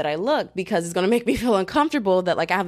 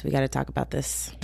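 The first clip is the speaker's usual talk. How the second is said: "We gotta talk about this" said much softer and lower, as an aside; quieter repeat; 6 semitones lower, 6 dB quieter than usual. Shown as a demonstration. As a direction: drop the volume and mutter it to yourself.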